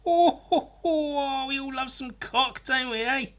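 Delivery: mock laddish rant; put-on bloke. Comedian voicing a crude bloke, hammed up.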